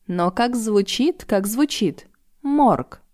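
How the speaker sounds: как stressed, drawn out slowly. A narrator's reading. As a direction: linger on как, then a high Морг. Savouring ominous word.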